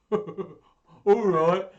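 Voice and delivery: loud monster chuckling into the words; laugh, then agree in a big voice; bursting with laughter. A narrator's reading. Gruffalo amused by the mouse's boast, laughing. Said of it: "bursting with laughter".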